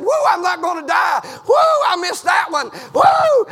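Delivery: loud, high whooping relief; preacher voicing David's joyful woo; excited whoop. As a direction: cry out like a man spared. David voiced rejoicing, preached.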